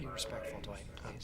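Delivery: low hushed whisper to the side; whispered aside. Real, not acted. Boss whispering at his salesman mid-call, hushed low whisper. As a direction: hiss a quick correction under the breath.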